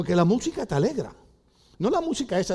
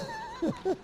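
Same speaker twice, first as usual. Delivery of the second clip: high, soft comic sobs; mock weeping; 10 dB quieter than usual. Old diner cries over a love song, mock sobbing. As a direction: whimper in falsetto bursts. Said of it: "se pone a llorar".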